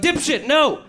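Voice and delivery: high mock yell after him; desperate call to stop him. Comedian calls after departing Brad, high mock yell. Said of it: "I was like".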